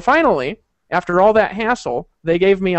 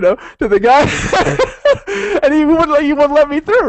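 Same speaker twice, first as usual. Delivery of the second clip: laughing through the words, high and loud; can't stop laughing while telling it; 9 semitones higher, 6 dB louder than usual. Real, not acted. Telling friends about the failed bribe, laughing hard.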